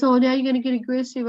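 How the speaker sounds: daughter's accusation. Retold or imitated. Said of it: "she goes".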